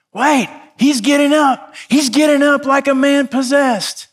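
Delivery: excited announcer. Retold or imitated. Ringside announcer calling the fighter rising from the canvas, thrilled shout. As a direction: yell it like a commentator who can't believe his eyes. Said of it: "all of a sudden you hear this".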